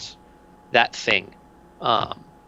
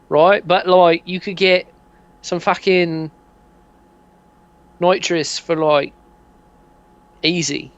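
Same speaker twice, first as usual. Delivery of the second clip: higher, chatty geezer pitch; dodgy dealer; 6 semitones higher than usual. Acted. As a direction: sell it like a dodgy mate. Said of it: "He goes".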